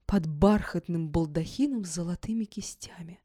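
ordinary delivery, nothing marked

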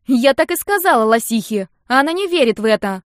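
high, loud wail; squealing girl's complaint; screeching like siren. A narrator's reading. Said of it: "Копируя сирену, заверещала сестрица".

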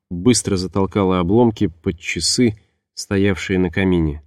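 usual speaking voice

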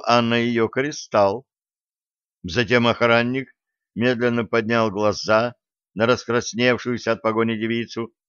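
usual speaking voice

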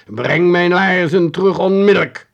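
ghostly figure demanding his boots back, threatening. A narrator's reading. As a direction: hit onmiddellijk hard as a threat. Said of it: "met dreigende stem riep".

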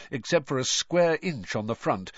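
ordinary delivery, nothing marked

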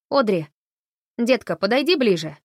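loud urgent call across the room. A narrator's reading. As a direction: call her over, loud. Calling the girl over urgently.

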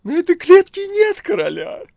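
very high, loud theatrical wail; hammed-up horror, squealed; cat howling in feigned horror. A narrator's reading. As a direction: howl as a cat faking terror. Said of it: "завыл кот, изображая ужас".

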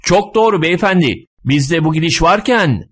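high-pitched, hearty agreement. A narrator's reading. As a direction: chime in from the crowd. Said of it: "destekleyen sesler yükseliyordu".

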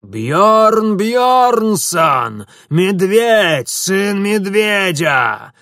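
громкий протяжный рёв, медленно; тянуть клич во всё горло; крик воинов. A narrator's reading. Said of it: "заорали воины". (протяжный боевой клич)

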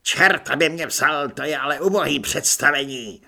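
loud drill-sergeant commands; barked orders. Acted. Corporal Řemendr bawling orders at recruits, loud. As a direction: bark orders at the whole room.

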